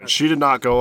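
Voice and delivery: usual speaking voice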